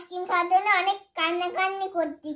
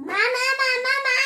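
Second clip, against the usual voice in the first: loud, higher repeated call; calling mum; 6 semitones higher, 5 dB louder than usual. Real, not acted. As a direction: keep calling out for mum, louder each time. Child calls mother repeatedly. (উঁচু গলায় মাকে বারবার ডাকা)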